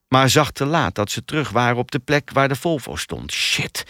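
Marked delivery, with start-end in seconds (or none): 3.3-3.8 unvoiced muttered swearword; low-key hissed mutter; muttered curse. A narrator's reading. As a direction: curse under your breath. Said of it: "mompelde Nicky".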